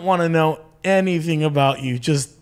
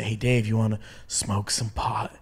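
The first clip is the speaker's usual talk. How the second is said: low, softer conspiratorial offer; furtive offer; 6 semitones lower, 7 dB quieter than usual. Retold or imitated. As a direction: slip it out low like a shady offer. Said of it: "with that kind of whisper".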